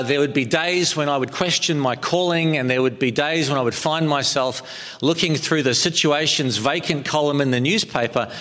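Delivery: normal talking voice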